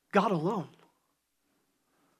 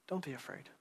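much softer, near a hush; soft voice of God; 7 semitones lower, 15 dB quieter than usual. Retold or imitated. Preacher voices God calming his people, very soft. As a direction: breathe it out as a comfort. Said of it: "the voice of God saying".

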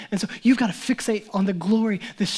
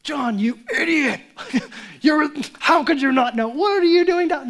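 high, loud, laughing mock rebuke; hammed-up exasperation at John; mock scolding Jesus; 7 semitones higher, 7 dB louder than usual. Preacher voicing a wrongly impatient Jesus, mock scolding.

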